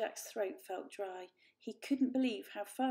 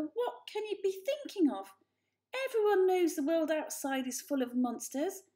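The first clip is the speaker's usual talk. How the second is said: higher, alarmed protest; fearful protest; 8 semitones higher, 5 dB louder than usual. A narrator's reading. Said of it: "cried Mother".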